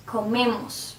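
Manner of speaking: comemos with the weight on "me"; comemos, middle syllable "me" stressed, last one light. Shown as a demonstration. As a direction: lean on the syllable before the ending in comemos. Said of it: "me, the syllable before the ending".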